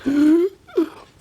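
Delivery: sharp high gasp of strain; high mock gasp. Voicing the straining electret.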